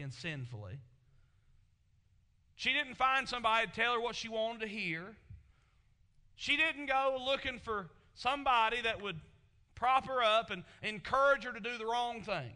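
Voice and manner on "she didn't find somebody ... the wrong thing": loud, ringing pulpit delivery; preaching at full force. Acted. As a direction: drive each line home to the congregation. Preacher builds to loud emphasis.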